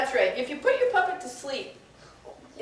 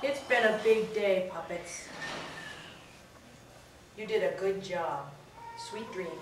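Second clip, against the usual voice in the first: lullaby hush; 4 semitones lower, 6 dB quieter than usual. Acted. Puppets put to sleep.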